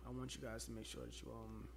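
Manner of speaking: very low, hushed and fast; creep voice. Pretend. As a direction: play the sleazy creep. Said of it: "Why are you putting on the creep voice right now?".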